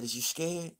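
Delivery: soft, hushed ask at night; quietly asking Bear through the wall; hushed question. Retold or imitated. Night talk between cells.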